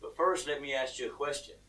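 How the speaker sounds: low, soft, composed voice; reply deep and unruffled; voice of God. Acted. God bargaining with the hanging man, low and calm. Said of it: "And God says".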